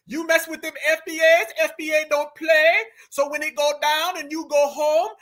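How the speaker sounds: playful mock Jamaican accent. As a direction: mimic her Jamaican patois, hammed up. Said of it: "she kicked into the full [name]".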